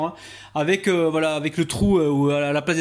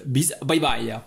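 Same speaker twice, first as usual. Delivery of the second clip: normal talking voice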